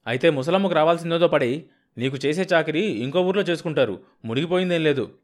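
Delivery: peeved brother-in-law hitting back; irritated, huffy. Acted. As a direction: snap back, fuming. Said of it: "రుసరుసలాడుతూ".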